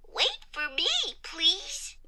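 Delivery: small, high pleading voice; Comma. A narrator's reading. Comma left behind and pausing, small high plea. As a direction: plead in a little voice that fades airy. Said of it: "Comma kept pausing".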